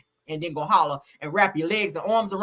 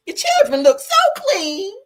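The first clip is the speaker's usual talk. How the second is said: very high, louder, slow fake-sweet gushing; Miss Millie; 7 dB louder, slower than usual. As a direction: coo over the children, high and syrupy. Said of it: "It's some Miss Millies out there".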